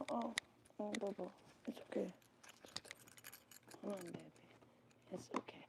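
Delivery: soft, hushed reassurance; comforting. Real, not acted. To the scared bunny under the bed, soft comforting.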